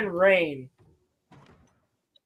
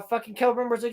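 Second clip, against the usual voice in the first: usual speaking voice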